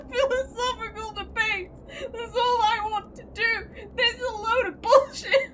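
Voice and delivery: high, loud whiny fake crying; mock distressed wail. Real, not acted. Player complaining to the game, mock sobbing. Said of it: "I'm so distressed".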